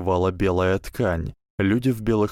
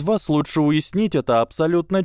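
lightly voiced, commanding schoolgirl; a hint of a bossy captain; stern girl leader; 8 semitones higher than usual. A narrator's reading. Susan's voice in the story. (строгий девичий голос командира)

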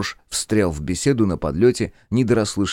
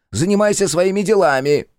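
angry; 12 semitones higher, 6 dB louder than usual. Acted. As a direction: snap at her in anger. Jester continues angrily.

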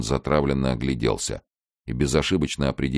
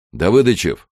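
louder, higher summons; calling out; 7 semitones higher, 8 dB louder than usual. Acted. Colonel summoning his lieutenant, calling out. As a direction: call a subordinate over.

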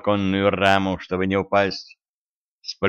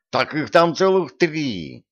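slow, higher indignant complaint; indignant protest; 7 semitones higher, slower than usual. A narrator's reading. Cat Vaska protests the workload, mildly indignant. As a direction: protest the heavy job. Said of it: "возмутился Васька".

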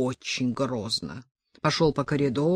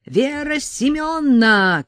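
high, slow, loud desperate call; desperate cry; 9 semitones higher, 6 dB louder, slower than usual. A narrator's reading. Sasha desperately calling the mother, high loud slow cry. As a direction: cry out for help in despair. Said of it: "крикнула Саша в отчаянии".

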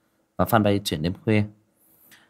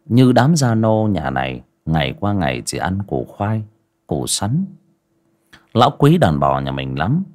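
horror story narration; 5 dB louder than usual. A narrator's reading. Storyteller opening a village ghost tale, grave narration. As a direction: draw listeners into the eerie night.

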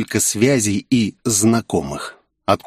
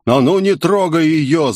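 puffing defiance; 6 semitones higher than usual. A narrator's reading. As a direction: puff out an angry challenge. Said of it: "запыхтел толстяк".

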